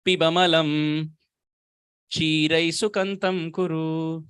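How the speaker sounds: slow, measured Sanskrit chant; verse recitation. Acted. Devotee reciting Prabodhananda's verse to the assembly. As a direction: intone the verse slowly, word by word.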